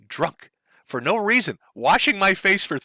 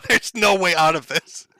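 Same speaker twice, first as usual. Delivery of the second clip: laughing; 5 semitones higher, slower than usual. Real, not acted. Laughing while describing defeated sigh.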